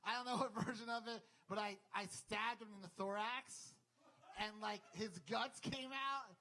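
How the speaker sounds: normal talking voice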